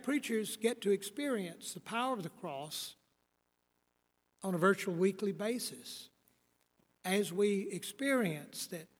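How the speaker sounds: ordinary talk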